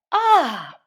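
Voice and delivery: high, loud relieved exclamation; high relieved sigh-ah. A narrator's reading. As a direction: let out relief. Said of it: "evidently relieved".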